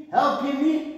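ordinary talk

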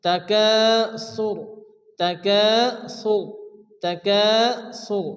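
slow "তাকাসুর", long "কা", thick final "র"; teacher's model "তাকাসুর"; full heavy R. Shown as a demonstration. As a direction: stretch "কা" and round off "তাকাসুর" heavily. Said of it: "একটু পুর করে মোটা করে".

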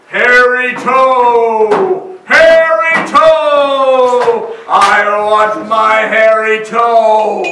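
slow, loud, drawn-out monster moan; a booming ghostly chant, long on each hairy toe; hollow rumbling voice. A narrator's reading. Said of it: "a hollow voice rumbled".

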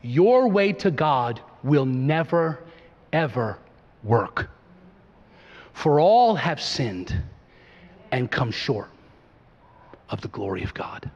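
slow, low, fading to hush; earnest pastor easing into softness; tender pastoral warning. Real, not acted. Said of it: "as a pastor in love".

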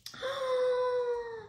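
sharp delighted gasp; excited gasp. Real, not acted. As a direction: gasp in surprise. Unboxing a cream contour.

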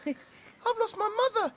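high squeaky chick voice; cheeping chick. Acted. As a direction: peep it like a lost chick. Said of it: "cheeped little chick".

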